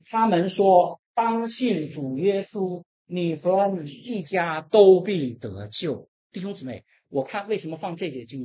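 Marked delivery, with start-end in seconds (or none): 0.1-6.1 slow, louder drawn-out scripture recitation; slow scripture reading. Shown as a demonstration. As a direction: read each word slowly aloud. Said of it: "我们一起来读". (缓慢庄重地诵读经文)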